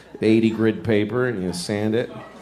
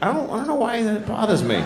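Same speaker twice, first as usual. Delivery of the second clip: high-pitched imitation of puzzled people; bothered audience member; 10 semitones higher than usual. People watching someone stand slightly wrong, high puzzled mimicry. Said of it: "just so people are like".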